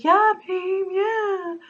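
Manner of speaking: mocking how the boyfriend brushed her off. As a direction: parody his easy agreement up high. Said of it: "And he's like".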